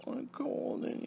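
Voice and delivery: high, slow breathy whine mimicking mother; mocking mom. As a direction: drag out her high complaint. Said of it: "She snapped at me".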